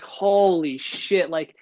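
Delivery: drawn-out, slow, mock dazed; slow stunned disbelief. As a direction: let each word hang in shock, slowly. Retold shock at bad news.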